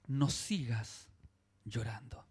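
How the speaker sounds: low, slow, then whispered; tender whisper. Retold or imitated. Jesus gently soothing the weeping widow, tender whisper. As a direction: let the last word fade into a whisper. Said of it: "la tierna".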